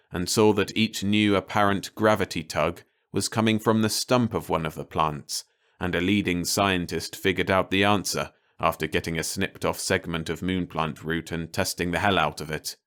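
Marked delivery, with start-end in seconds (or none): none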